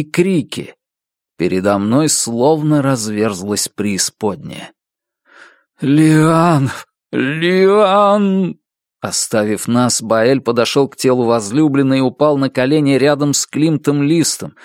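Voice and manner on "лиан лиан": crying out. Acted. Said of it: "Он тихо плакал".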